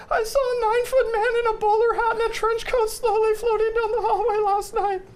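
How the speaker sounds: put-on high, breathless little girl voice; scared little girl. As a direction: play the frightened daughter. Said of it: "your daughter comes to you and says".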